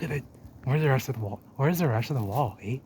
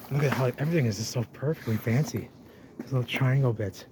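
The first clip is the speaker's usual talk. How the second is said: normal talking voice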